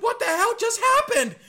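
high, loud incredulous outcry; stunned disbelief. Retold or imitated. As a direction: yell in shock at the ref's call. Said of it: "and I'm just like".

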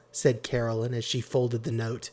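ordinary delivery

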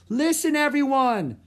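high, loud shout to a crowd. A narrator's reading. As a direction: call everyone to attention. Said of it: "shouted Annie".

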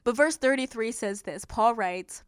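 normal talking voice

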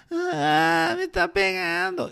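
put-on high, slow childish whine, a kid tattling; whiny kid voice. A kid complains to the teacher about being hit, in a high whine. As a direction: whine it like a little kid telling on a classmate.

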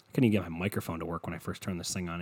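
ordinary talk, nothing marked

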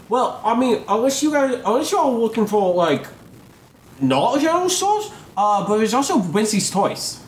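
high Cockney shop lady; chirpy Cockney helpful aside; Cockney accent. Acted. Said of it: "doing this Cockney accent".

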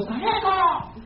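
high-pitched, softened mock holler; grito imitado. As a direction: imitate a distant yell without full volume. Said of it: "le gritaban a otro muchacho". (grito agudo imitado a media voz)

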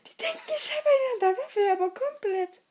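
high fast laugh-crying. Real, not acted. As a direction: squeal it through laughter.

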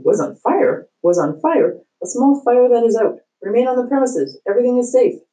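a principal calling through a bullhorn, trying to calm the crowd; bullhorn announcement. Acted.

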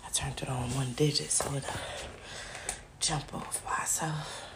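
voiceless whisper. Real, not acted. Speaks softly off mic.

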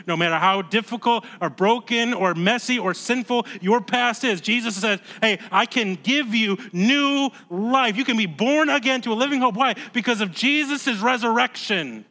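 fervent preacher pressing the point home; impassioned preaching. Real, not acted. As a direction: proclaim the promise to the congregation. Preaching climax.

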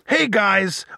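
normal talking voice

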